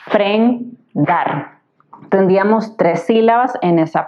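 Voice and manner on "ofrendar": "ofrendar" very slow, o, fren, dar, with gaps; syllable by syllable. Shown as a demonstration. Teacher showing students the division, "ofrendar" syllable by syllable. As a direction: dictate "ofrendar" as three detached syllables. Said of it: "separamos la palabra ofrendar en sílabas".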